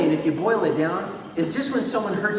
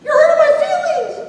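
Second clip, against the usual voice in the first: much higher, loud whiny cry; shrill victim voice; 11 dB louder than usual. Acted. Victim yelps back at the bully, high and loud. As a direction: squeal it like a hurt kid.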